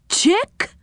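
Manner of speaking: high questioning pipe, rising; high comical puzzlement; puzzled character voice. Acted. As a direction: query it high, as if misheard. Deaf saucepan man mishears a name.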